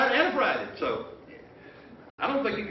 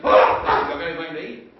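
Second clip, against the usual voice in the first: laughing; 10 dB louder than usual. Acted. Jesus asks for food, preacher amused.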